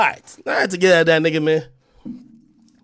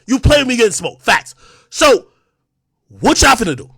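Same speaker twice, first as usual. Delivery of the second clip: ordinary delivery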